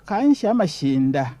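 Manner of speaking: normal talking voice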